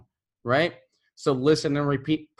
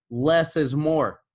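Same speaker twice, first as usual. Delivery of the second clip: less is more, slowly, with the weight on less; slow emphatic; slower than usual. Shown as a demonstration. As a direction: drive less is more home like a motto. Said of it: "I always say this to my students".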